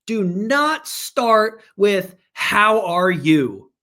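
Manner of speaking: very slow, emphatic plea; passionate. Real, not acted. Coach begging investors not to open that way, passionate. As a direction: hammer it out word by word, slow and forceful. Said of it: "I get really passionate".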